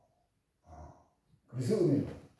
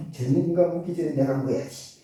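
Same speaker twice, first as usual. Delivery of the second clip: ordinary talk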